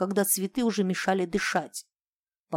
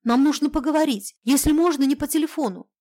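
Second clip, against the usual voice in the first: urgent; 7 semitones higher, 6 dB louder than usual. A narrator's reading. Breathless call right after waking.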